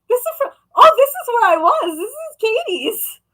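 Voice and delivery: high, loud burst of recognition; excited mock astonishment; sudden realization. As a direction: blurt it out high, as if it just dawned on you. Acted sudden recognition of the place.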